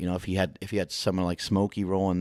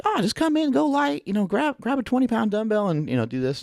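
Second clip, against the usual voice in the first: much higher, louder breezy put-on voice; mock coach voice; 4 dB louder than usual. Coach advising light shoulder work, high mock voice. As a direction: toss off the advice like it's nothing, pitched way up. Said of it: "He's like".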